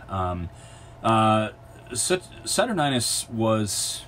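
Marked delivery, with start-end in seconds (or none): 2.4-3.3 Saturninus with NINE as the third syllable; another pronunciation variant. Real, not acted. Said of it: "go back with the original pronunciation".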